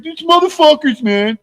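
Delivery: high mock rant; mocking Flax. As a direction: whine it like a sore friend. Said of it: "he's like".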